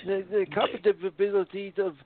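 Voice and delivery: put-on high, halting old man voice. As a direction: play a confused old man. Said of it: "You're picking on Joe Biden".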